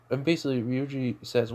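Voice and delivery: ordinary delivery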